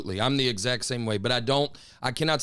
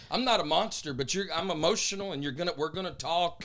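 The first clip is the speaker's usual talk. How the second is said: raised pitch, worked-up protest; heated retort; 7 semitones higher than usual. Retold or imitated. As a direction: let it spill out, emotional and high. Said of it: "I just wanted to be like".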